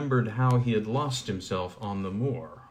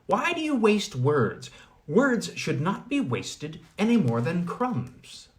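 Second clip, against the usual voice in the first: put-on scolding old woman; 7 semitones higher than usual. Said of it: "said the old woman".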